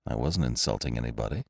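low, soft, quick denial; hushed, hurried answer; subdued reply. A narrator's reading. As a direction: brush it off quietly and quickly. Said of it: "he replied".

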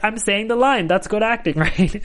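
proud of getting it wrong; naive. Retold or imitated. Naive younger self quoted.

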